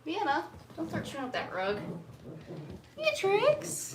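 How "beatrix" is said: high, rising call of the name; calling the dog. Real, not acted. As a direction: call her from across the room.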